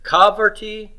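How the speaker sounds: kavarti with the first syllable KA hit high; stress on first syllable. Shown as a demonstration. Teacher offers options for where the accent goes, kavarti stressed on the first syllable. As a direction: put the accent at the front of kavarti, KA-varti. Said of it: "Where's the accent go on this?".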